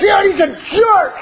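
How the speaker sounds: high mock shout of an indignant boy; kid protesting. Son complaining to dad about the coach, high mock shout. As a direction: whine it like a kid insisting he's right.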